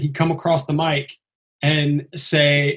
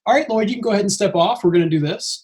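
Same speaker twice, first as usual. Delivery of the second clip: fast brisk PA; 6 semitones higher, faster than usual. Retold or imitated. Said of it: "they're like".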